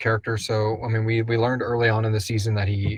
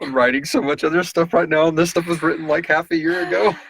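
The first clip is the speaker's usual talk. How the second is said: higher, louder rush of excuse; excited excuse; 7 semitones higher, 7 dB louder than usual. Real, not acted. Excusing a bad memory to viewers, higher and louder. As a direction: laugh it off, louder and up.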